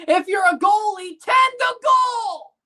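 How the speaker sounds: angry shout. Real, not acted. Fan yelling at his goalie on TV, furious shout. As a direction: bellow it at the screen like a furious fan.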